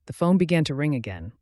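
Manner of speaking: plain reading voice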